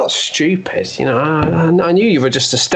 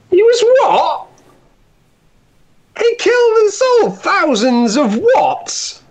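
horrified shock; slower than usual. Acted. Lover reading about the statue's past, horrified shriek. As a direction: shriek it as the truth dawns. Discovers statue was slave trader.

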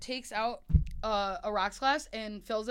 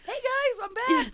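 high, loud mock holler; acted-out loud announcement of arrival; calling out; 7 dB louder than usual. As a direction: call out to the whole bar. Banned man coming back in.

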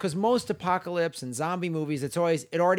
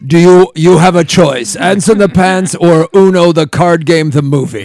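loud; 15 dB louder than usual. Real, not acted. Laughing loud joke.

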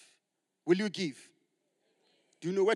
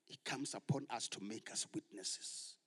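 softer whispered point; 3 semitones lower, 6 dB quieter than usual. Acted. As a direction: whisper it like a secret truth. Preacher hushes for emphasis.

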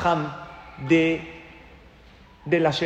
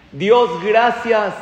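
loud, higher, fervent thanks; retold tearful prayer; 5 semitones higher, 9 dB louder, much slower than usual. Young man thanking God in prayer, loud fervent imitation. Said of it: "Y así con lágrimas en los ojos".

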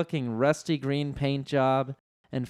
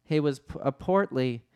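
usual speaking voice

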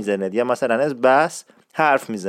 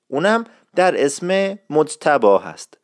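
ordinary talk, nothing marked

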